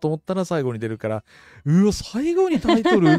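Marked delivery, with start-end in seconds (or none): 1.6-3.1 a raised, drawn-out "wow, the title at the end?"; mock surprise. Friend recalling the movie's ending title, mock surprised. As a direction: replay your stunned reaction, slower and higher. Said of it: "みたいな".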